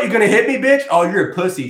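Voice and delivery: high, loud imitated sneering dare; mock yelled taunt. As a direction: goad him, acting her out. Acting yelling chick taunting man.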